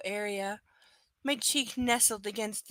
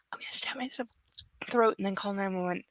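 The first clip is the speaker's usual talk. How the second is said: whisper; faster than usual. Real, not acted. Mock threat about hiccups.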